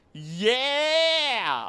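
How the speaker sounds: long, high, loud yeah; excited cheer. Real, not acted. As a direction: cheer it out and hold it. Drawn-out cheer on reading message.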